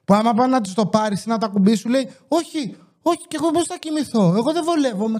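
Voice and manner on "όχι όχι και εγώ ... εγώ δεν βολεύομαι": imitating girlfriend. Boyfriend mimics his girlfriend refusing to give up her pillow, whiny and high. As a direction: mimic her fussy pillow protest, pitched up.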